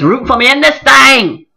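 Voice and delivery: rousing call-back; preacher call. Real, not acted. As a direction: belt it like a preacher.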